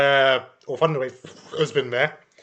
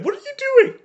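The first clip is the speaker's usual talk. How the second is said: alarmed quote; 5 dB louder, much faster than usual. Retold or imitated. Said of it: "you see Kim".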